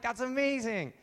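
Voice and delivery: high-pitched awed excitement; amazed crowd. Acted. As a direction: exclaim like a thrilled onlooker. Amazed crowd reacts to the stunt.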